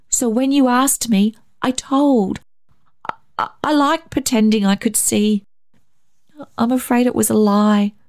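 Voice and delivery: put-on high, hesitant child's voice; confessing boy. A narrator's reading. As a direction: own up as a little boy. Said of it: "David confessed".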